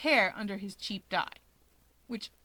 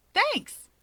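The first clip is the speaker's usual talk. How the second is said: much higher, beaming and loud; bright thanks; 12 semitones higher, 5 dB louder than usual. Acted. Natsuo to Shigaraki, beaming thanks. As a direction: thank him with a blinding grin.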